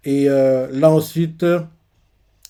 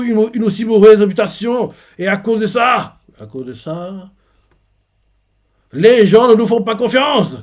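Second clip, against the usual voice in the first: loud, higher, slowing angry shout; furious tirade; 6 semitones higher, 6 dB louder than usual. Retold or imitated. Harald yells at the giant he is beating, furious. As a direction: rage at him between blows.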